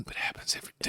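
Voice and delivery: hushed unvoiced aside; whispering. Real, not acted. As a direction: drop to a whisper.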